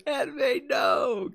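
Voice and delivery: hammed-up grief, crying out her name; anguished cry. Player watching Aunt May dying, mock anguished wail. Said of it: "I was like".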